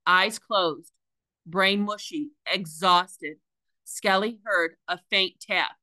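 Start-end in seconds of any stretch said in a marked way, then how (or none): none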